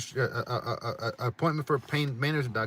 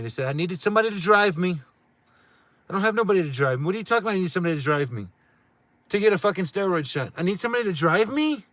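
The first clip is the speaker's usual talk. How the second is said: higher and louder, incredulous complaint; exasperated loud rant; 7 semitones higher, 8 dB louder than usual. Real, not acted. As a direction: vent the absurd rule at full volume. Frustrated rant about needing driver.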